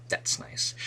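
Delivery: soft unvoiced whisper to herself; whispered aside. Real, not acted. Teacher whispers a wry aside after the troll sentence. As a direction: breathe it out as a private aside.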